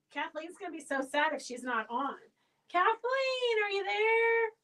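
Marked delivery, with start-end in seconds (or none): 2.7-4.5 calling out. Real, not acted. Calling out for missing winner.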